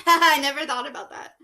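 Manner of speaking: loud real laugh through I never thought about that. Real, not acted. Reader cracks up at the book's joke, laughing.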